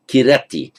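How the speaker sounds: "kirati" with a clear i after the k, said firmly; kirati not karati. Shown as a demonstration. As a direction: stress "kirati" on ri, with a short i in ki. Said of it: "If it gunated ... karati".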